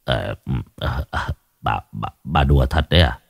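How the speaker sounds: low, soft, hesitant stammer; bewildered. A narrator's reading. Husband questioning his wife's joke, bewildered stammer. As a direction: falter and repeat words in confusion. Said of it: "ngơ ra hoang mang nhìn vợ".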